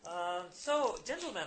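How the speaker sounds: high, loud stage-Irish greeting; Irish accent. Acted. Grubby newspaperman greets the American visitors, Irish accent. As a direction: hail them like a jolly Irish publican. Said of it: "can I do the accent right?".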